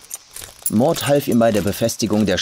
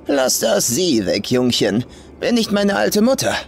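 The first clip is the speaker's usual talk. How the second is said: put-on rough old farm woman; 9 semitones higher than usual. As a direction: sneer it as the crude old farmer.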